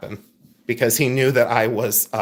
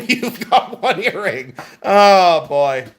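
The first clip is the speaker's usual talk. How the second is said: laughing; 5 semitones higher, 3 dB louder, slower than usual. Real, not acted. Teasing a viewer about single earring.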